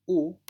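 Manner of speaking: "U" called out loud and high, a pure u with no h; clear vowel demo. Shown as a demonstration. Teacher modelling the Greek diphthong for the class, loud clear vowel. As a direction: sing out a bare "U" as a model. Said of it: "se pronuncia U".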